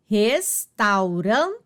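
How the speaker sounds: "restaurante" slowly, res-tau-ran-te; divisão silábica. Shown as a demonstration. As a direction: break "restaurante" into syllables, keeping tau whole. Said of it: "na mesma sílaba". ("restaurante" bem devagar, sílaba por sílaba)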